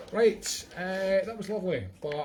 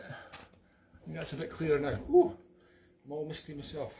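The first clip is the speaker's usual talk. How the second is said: ordinary talk, nothing marked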